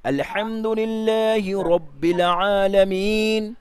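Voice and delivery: slow, drawn-out chanted recitation; slow Quranic recitation. Shown as a demonstration. Teacher reciting slowly to show the rounded sound. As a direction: recite each word slowly in the Quran style. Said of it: "সব দুই ঠোঁট গোল করলে".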